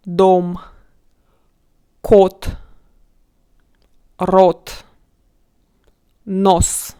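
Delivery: дом, кот, рот, нос loud, with a round o and long pauses; full O sound. Shown as a demonstration. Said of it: "we pronounce those words with O".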